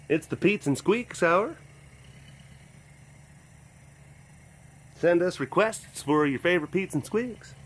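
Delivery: lightly played radio announcer patter; host it like an easy listening station, half joking; playful radio host bit; mock radio DJ. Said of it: "there's a lot of radio things that you're saying".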